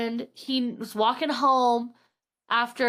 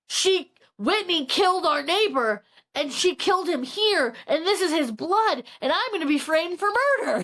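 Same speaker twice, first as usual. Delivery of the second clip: higher, emphatic, mounting alarm in retelling; mock alarmed realization; 5 semitones higher than usual. Man realizing he will be framed, acted alarm. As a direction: piece it together with acted alarm.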